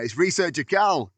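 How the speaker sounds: normal talking voice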